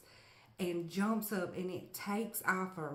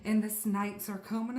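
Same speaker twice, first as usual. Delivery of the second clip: ordinary delivery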